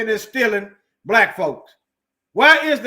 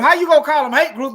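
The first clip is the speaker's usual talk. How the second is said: higher and louder, outraged question; indignant; 7 semitones higher, 6 dB louder than usual. Real, not acted. Speaker to his viewers, indignant outrage. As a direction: throw the question back at them.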